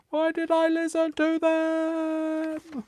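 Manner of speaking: very high, long screamed lament; re-enacted roller-coaster scream. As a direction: scream the question out slowly, stretching the last word. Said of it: "Whilst also screaming".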